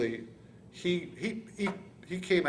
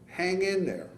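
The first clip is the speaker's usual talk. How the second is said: raised, drawn-out call over noise; retold yell; 5 semitones higher, 4 dB louder, slower than usual. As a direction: call it out higher, imitating a crewman. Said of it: "yelling over the thing".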